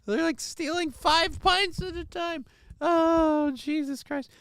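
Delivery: high, slow disbelief; incredulous. Real, not acted. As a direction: say it like you can't believe the number. Incredulous exclamation.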